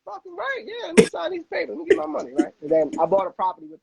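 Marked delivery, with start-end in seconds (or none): 0.1-2.3 high, eager burst of delight; thrilled. Retold or imitated. Borrower thrilled when the banker offers the loan now, high and eager. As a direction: grab the money, can't wait. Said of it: "I'm like".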